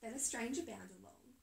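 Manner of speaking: plain reading voice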